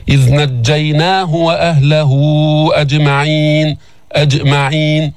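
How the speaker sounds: measured recitation, أجمعين as "aj'ma'een" with echoing jim; qalqala on jim. Shown as a demonstration. Teacher models verse, bouncing the still jim in أجمعين. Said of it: "هنا القلقلة في الجيم الساكنة".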